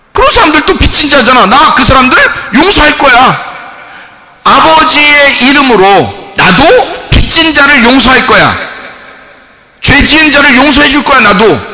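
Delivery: high, loud declaration; fiery preaching. Acted. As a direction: preach with conviction. Preaching at full force.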